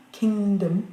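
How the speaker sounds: "kingdom" slow, stressed "king", reduced "dom"; weak "dom" ending. Shown as a demonstration. Teacher giving an example, "kingdom" with stressed "king" and weak "dom". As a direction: punch "king" in "kingdom" and swallow the vowel of "dom". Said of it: "mais vraiment kingdom".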